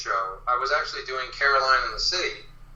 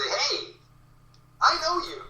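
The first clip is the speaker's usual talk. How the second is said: high, eager voice of a stranger who thinks he knows you; a hint of a puzzled fan pitched up high; excited fan; 14 semitones higher than usual. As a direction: lightly play a fan who half recognizes someone. Fan recognizing him in public.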